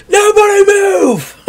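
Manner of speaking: loud, high barked command; shouted order. Acted. Town guard bursting in, yelling at the tavern crowd. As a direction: freeze everyone with a yell.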